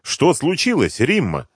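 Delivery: high-pitched puzzled question; недоумённо. A narrator's reading. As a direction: ask in bewilderment, starting high. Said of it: "с недоумением спрашивали". (с недоумением, на высокой ноте)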